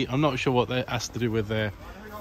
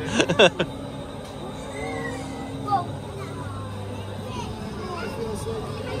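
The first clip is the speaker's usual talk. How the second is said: giggling on the ride; 3 dB quieter than usual. Real, not acted. Laughing on the slide ride.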